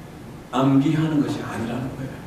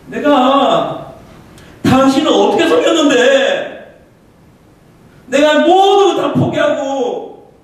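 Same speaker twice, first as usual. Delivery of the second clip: raised, slow, anguished protest; angry outcry at God; 11 semitones higher, 7 dB louder than usual. Retold or imitated. Preacher reliving his furious complaint to God, anguished raised outcry. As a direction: cry out against God in bitter grievance. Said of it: "원망과 불평이".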